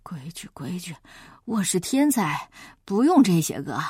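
low, drowsy whispered brush-off; sleepy whisper. Acted. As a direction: mumble him away half asleep. Sleepy braggart waves him off.

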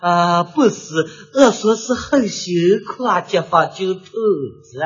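slow, low-voiced local explaining in dialect; a hint of a local stammering apology; 慌忙解释的方言口音; flustered dialect speaker. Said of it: "这个人赶紧解释".

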